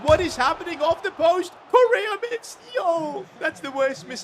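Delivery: high, loud relieved yell; shocked shout. Real, not acted. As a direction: scream in relief at the miss. Opponent hits post and misses.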